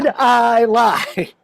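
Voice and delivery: very drawn-out, laughing challenge; playful protest. Real, not acted. Comic repeating his challenge to audience, drawn out. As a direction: stretch every word to milk the crowd.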